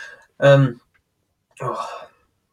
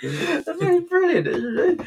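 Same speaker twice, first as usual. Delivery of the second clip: laughing; 3 dB quieter, much slower than usual. Real, not acted. Laughing after punchline.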